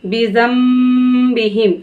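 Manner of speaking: slow recitation of बी जम बी हिम with nasal ghunna on the मीम; Quranic recitation. Shown as a demonstration. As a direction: draw out बी जम बी हिम, nun turned to meem. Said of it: "इस तरीके से".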